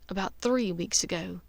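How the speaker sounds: ordinary delivery, nothing marked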